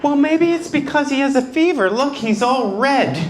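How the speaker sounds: high, put-on doting mother; a hammed-up fussy mom; fussing mom. Mom fussing over her son's face, in a high mock voice.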